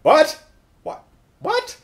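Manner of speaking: high squeaked disbelief; incredulous. Acted. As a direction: yelp it in mock astonishment. Incredulous reaction to father's refusal.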